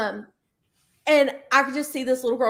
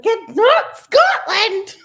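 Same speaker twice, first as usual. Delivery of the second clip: slow, higher, louder mock outrage; mock indignant protest; 8 semitones higher, 7 dB louder, much slower than usual. Imitating the Irish friend's offended outburst, slow and loud. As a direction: drag out the offended protest, hammed up.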